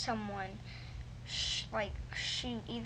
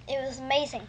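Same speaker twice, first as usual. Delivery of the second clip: ordinary talk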